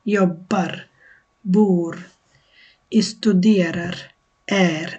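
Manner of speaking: studerar drawn out very slowly, syllable by syllable; slow demo of studerar; slow model word. Shown as a demonstration. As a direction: stretch studerar out for learners. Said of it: "teacher modelling Swedish word".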